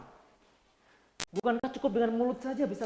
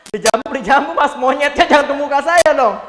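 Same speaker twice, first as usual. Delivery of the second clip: loud, indignant mock protest; offended complaint; 5 semitones higher, 16 dB louder than usual. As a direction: play the insulted customer. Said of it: "offended listener complaining". (pelanggan tersinggung, protes keras)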